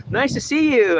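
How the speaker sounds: high, sugary nice to see you; singsong parody of a polite hello; mocking fake greeting. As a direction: mimic the phony greeting up high.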